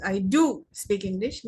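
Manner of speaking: "do" stands out from the sentence; emphatic "do" in a teacher's demonstration; stress on DO. Shown as a demonstration. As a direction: put the stress on "do". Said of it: "you are putting a stress".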